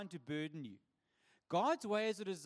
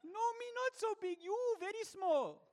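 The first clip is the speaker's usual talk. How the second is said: imitating the tourists and reply; 14 semitones higher, 4 dB louder than usual. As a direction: act out both sides of the joke. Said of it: "suddenly they see".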